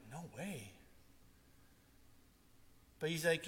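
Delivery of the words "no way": quiet refusal. Retold or imitated. Retells his own reluctant private answer.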